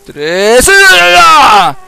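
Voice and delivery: very loud, high, drawn-out yell; screaming. Real, not acted. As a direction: count rising into a huge high scream. Countdown bursts into scream at jump.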